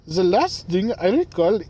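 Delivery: put-on German accent slipping into Indian; botched German accent. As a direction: attempt a loud German accent that comes out Indian. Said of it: "That is not German. That was freaking Indian".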